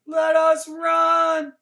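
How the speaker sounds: very high, loud, slow cry; imagined horses screaming, very high, loud and slow. A narrator's reading. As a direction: cry out like trapped horses begging. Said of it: "heard them screaming".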